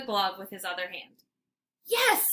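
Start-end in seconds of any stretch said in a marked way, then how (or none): 1.9-2.2 high, excited shout; joyful cry. Acted. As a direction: cry out in triumph. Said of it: "Hetty cries".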